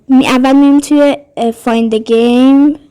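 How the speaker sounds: normal talking voice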